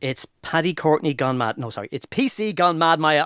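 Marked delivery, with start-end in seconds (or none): none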